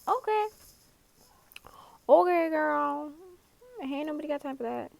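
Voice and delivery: channel the viral sassy lady; hammed-up diva brush-off; sassy meme voice. Sassy meme quote to Gon.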